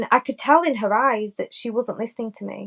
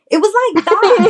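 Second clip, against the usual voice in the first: high, loud, delighted burst; excited exclamation; 9 semitones higher, 8 dB louder than usual. Real, not acted. Punchline after Mean Girls quote.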